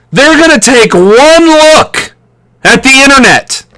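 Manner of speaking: ordinary talk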